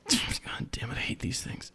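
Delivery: annoyed whispered cursing; whispered curse. Acted. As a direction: hiss it under your breath. Frustrated caller mutters aside.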